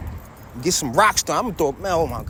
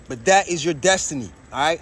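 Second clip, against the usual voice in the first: ordinary talk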